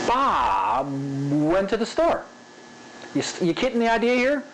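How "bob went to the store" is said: long drawn-out "Bob", then quick rest; varied spacing. Shown as a demonstration. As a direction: stretch "Bob" way out, rush "went to the store". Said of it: "where you linger, and where you rush".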